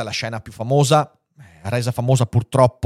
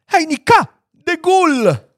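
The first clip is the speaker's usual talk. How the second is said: much higher and louder squeaky little-man shout; shrill tiny Lilliputian cry; 8 dB louder, slower than usual. Acted. The little Lilliputian on Gulliver's chest admiring him, shrill high cry. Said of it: "gridò con voce stridula ma distinta".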